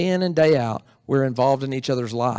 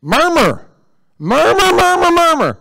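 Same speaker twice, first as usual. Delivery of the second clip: hammed-up murmur, much higher and louder; mock grumbling; 12 semitones higher, 9 dB louder than usual. Pastor mocking the congregation's grumbling, hammed-up murmur. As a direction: parody a crowd of complainers.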